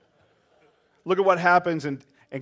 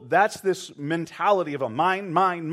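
normal talking voice

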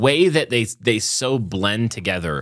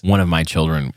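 ordinary talk, nothing marked

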